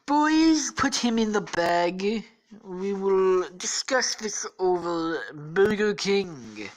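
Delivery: high, loud, slow drawl; hammed-up presidential impression, stretching the words; Donald Trump. Trump orders his men to bag Gareth, hammy impression. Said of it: "says Trump".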